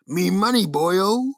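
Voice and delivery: high, loud pirate boss; Mr. Krabs. Acted. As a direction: cry out for his money. Mr. Krabs voiced.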